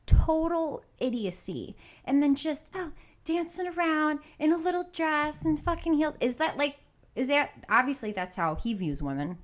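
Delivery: ordinary talk, nothing marked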